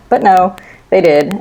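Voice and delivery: usual speaking voice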